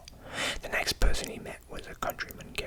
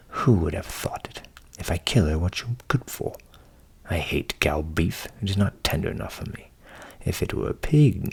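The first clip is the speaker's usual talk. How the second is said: a touch of rueful complaint; lightly voiced Hans, rueful; 8 semitones lower, 5 dB louder than usual. Hans grumbling to the butcher, mildly rueful. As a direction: lightly play a simple lad reckoning his loss. Hans bemoans his cow.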